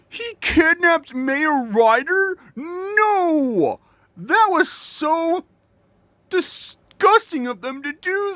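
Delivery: hammed-up shocked cry; mock shock. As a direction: gasp out the news in pretend horror. Shocked at kidnapping news.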